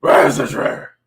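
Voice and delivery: loud, harsh growling voice; hammed-up rough hero voice; put-on vigilante growl. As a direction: growl it from the throat, straining the vocal cords. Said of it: "Why are you talking like that?".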